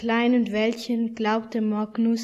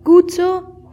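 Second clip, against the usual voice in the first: high, louder proud question; eager little boy; 7 semitones higher, 9 dB louder than usual. Acted. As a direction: show off the armour, high and bright. Tiny knight asks, proud.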